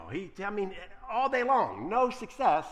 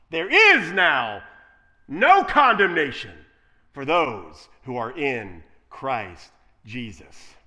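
slow and much louder, word by word; scripture proclaimed; 4 semitones lower, 9 dB louder, slower than usual. Acted. Preacher quoting God to the congregation, slow loud proclamation. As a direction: ring out each word like a verdict.

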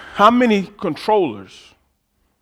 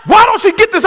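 loud, high, exasperated plea; husband shouting his frustration to God; frustrated shout to God; 7 dB louder than usual. Retold or imitated. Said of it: "I thought I had to".

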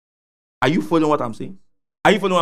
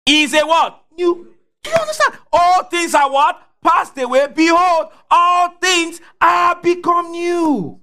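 preaching at full force; 9 semitones higher than usual. Acted. Preacher shouting the verse to his congregation, high and slow. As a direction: drive each line up to the congregation, slowing on the last.

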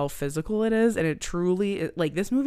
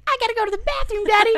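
put-on higher, kid begging from the backseat; nagging little kid; whiny child voice; 4 dB louder, faster than usual. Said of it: "Somebody's yelling in the backseat".

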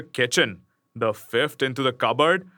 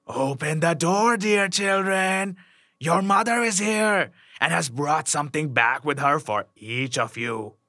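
wolf's rough voice; 2 semitones higher than usual. A narrator's reading. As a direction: coax them roughly, drawing words out. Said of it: "the wolf by his rough voice".

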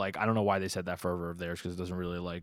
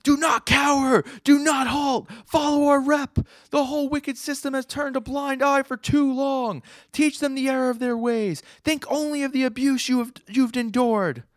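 high, booming villain rallying cry; spider guy rallying shout; 8 dB louder than usual. Retold or imitated. Spider villain urging the marching crowd, high booming shout. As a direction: roar orders at the crowd like a fanatic leader. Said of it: "the spider guy's like".

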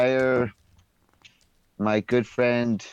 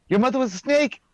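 mocking taunt; 11 semitones higher than usual. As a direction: jeer at him like a bratty kid. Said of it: "turn to him and go".

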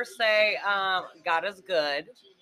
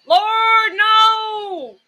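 sudden high yell at the game; 14 semitones higher, 12 dB louder than usual. Real, not acted. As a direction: shout in dismay. Yelling at football game on TV.

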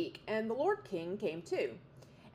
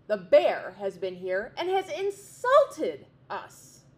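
angry chicks; 4 semitones higher, 6 dB louder than usual. A narrator's reading. Young wrens refusing food from parents, loud indignant. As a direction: refuse the food in a furious sulk. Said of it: "they were very angry".

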